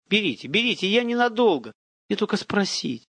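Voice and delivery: high, eager entreaty; high-pitched placating voice; pleading. A narrator's reading. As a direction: coax them anxiously to agree. Father begging guards with a bribe.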